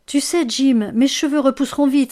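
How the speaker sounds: cheerful cry that "mes cheveux repousseront vite"; exclaiming. A narrator's reading. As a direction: brush off the loss brightly. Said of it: "s'exclama Delia".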